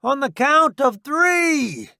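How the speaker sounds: high, loud cocky soldier; a grinning, reckless army buddy; brash soldier voice. Acted. Roddy counting down.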